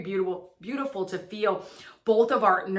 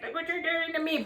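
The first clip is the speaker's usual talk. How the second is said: mock a selfish partner's complaint, pitched way up; mock self-pitying blame; 9 semitones higher, 3 dB quieter than usual. Selfish past self attacking struggling partner, mock whiny blame.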